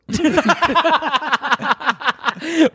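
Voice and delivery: high laugh. Real, not acted. Laughing at the story.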